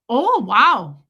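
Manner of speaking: loud Midwestern marveling; Midwestern accent. Retold or imitated. Stranger behind her in the aisle, Midwestern accent. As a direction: imitate an impressed Midwesterner's accent. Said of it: "it was a sympathy accent".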